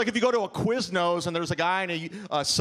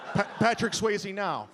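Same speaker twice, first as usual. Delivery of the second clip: usual speaking voice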